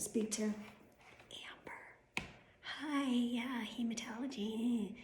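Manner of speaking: unvoiced whisper of the phone call; hushed retelling. Retelling the office call in a whisper. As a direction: whisper the receptionist's lines.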